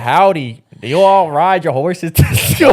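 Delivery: slow, laughing mock Southern accent; badly faked Southern accent, laughing through it; country accent. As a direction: crack up while hamming a cowboy drawl. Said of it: "do your best country accent".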